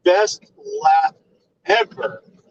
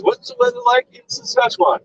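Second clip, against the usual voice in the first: mock TV host. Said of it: "He goes".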